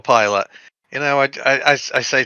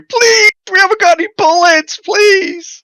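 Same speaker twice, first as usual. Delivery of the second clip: high, hammed-up pleading; begging; 3 dB louder than usual. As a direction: beg for ammo in a high, desperate mock voice. Acted begging for ammunition.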